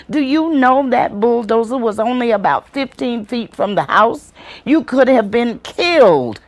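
high-pitched, alarmed scolding in rapid questions; aunt screaming and yelling. Acted. Aunt to child who ran into the house, yelling scolding. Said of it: "She was screaming and yelling".

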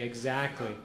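ordinary talk

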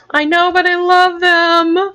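gushing. Real, not acted. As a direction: burst out with it, drawn out. Same words repeated loudly.

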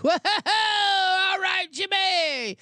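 amused, disbelieving shout at Jimmy; laughing shout. Real, not acted. Laughing at Butler's reply.

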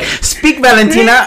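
high, put-on diva order; hammed-up high-pitched summons; theatrical command. Acted retort to the ally remark.

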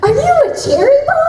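put-on high, light little-girl voice; childlike character voice. Voice actor quoting her sweet little character. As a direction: pipe it up like an innocent kid.

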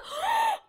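very high, squeaky intake of breath; horrified gasp. Real, not acted. Player realising whose room it is, horrified high gasp. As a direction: suck in a shrieking gasp. Horrified discovery mid-sentence.

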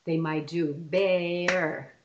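put-on "bear" stretched slowly as a child might clap it; drawn-out childlike "bear"; mock child syllables. Children getting syllables wrong, slow and stretched. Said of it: "it's tricky".

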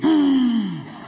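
a loud shocked gasp, rising. Retold or imitated. José Luis reacting to the shove, a loud shocked gasp. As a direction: gasp in horror.

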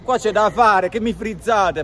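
loud, high exasperated swearing; explosive italian cursing. Real, not acted. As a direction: curse the frozen stream. Stream freezes, he curses.

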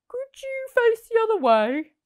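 fussed Percy. Acted. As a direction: ask nicely but squashed. Percy asks squirrel.

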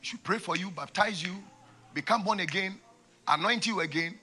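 ordinary talk, nothing marked

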